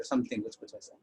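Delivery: hushed, almost whispered aside; quiet aside. Real, not acted. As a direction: drop to a whisper, a little sheepish. Embarrassed aside after the taunt. (फुसफुसाकर धीमी बात)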